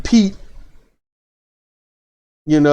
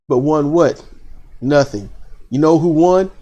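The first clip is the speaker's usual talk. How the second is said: ordinary talk, nothing marked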